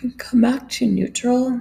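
words stretched by a yawn, airy. Real, not acted. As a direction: say it through a big yawn. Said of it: "Excuse my yawn".

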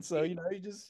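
normal talking voice

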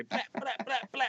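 high-pitched mouth gun noise; machine gun sounds. Pretend. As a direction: sputter a girl's gun sounds. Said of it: "She's gonna say".

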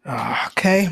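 ordinary talk, nothing marked

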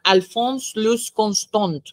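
name said slowly in a French accent. Shown as a demonstration. Podcaster mocks own French accent saying the name, slow French accent. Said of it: "mi asqueroso acento francés".

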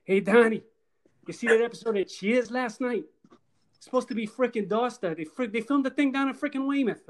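lightly voiced Boston teen accent. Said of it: "picture the [name], like, as teenagers".

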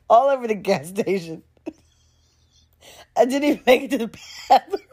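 rushing the line through real laughter; words tumbling out fast between laughs; giggling too hard to keep a straight face; laughing. Real, not acted. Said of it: "trying with everything inside of me not to scream, laugh".

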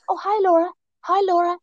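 put-on high-pitched greeting with a half-hearted Scottish accent; barely attempted Scottish accent, pitched high; mock Scottish neighbour. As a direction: lightly imitate a Scottish neighbour calling hello. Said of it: "in her accent".

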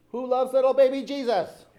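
loud, high mock demand; insistent shout, pitched right up; growing intensity. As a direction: imitate kids shouting harder. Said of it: "intensity that grew with each repetition".

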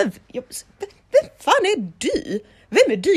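high, loud, outraged retort; indignant outburst. Retold or imitated. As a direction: fire back in disbelief. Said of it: "Jag bara". (högt och upprört)

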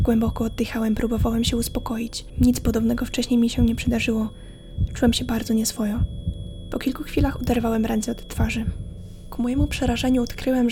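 ordinary talk

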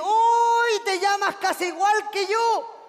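high, loud, drawn-out squeal; hammed-up high delight; thrilled woman quote. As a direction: gush loudly over the coincidence.

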